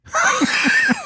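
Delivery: high shrieking laugh. Real, not acted. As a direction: erupt into a big laugh. Big laugh at the joke.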